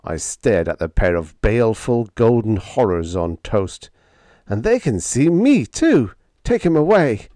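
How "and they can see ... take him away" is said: high, raised cry of revulsion; hollering. A narrator's reading. Sparks wants the poached eggs gone, hollering. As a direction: holler in comic horror, pitched up. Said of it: "I hollered".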